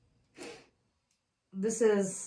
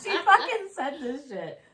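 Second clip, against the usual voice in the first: ordinary talk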